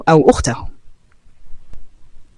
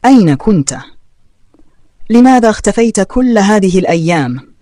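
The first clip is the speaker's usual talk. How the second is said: mildly sharp questioning, raised in pitch; 5 semitones higher than usual. A narrator's reading. Sister confronting her brother after his absence, mildly sharp. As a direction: demand an answer with a touch of sharpness. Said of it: "وسألته بحدة".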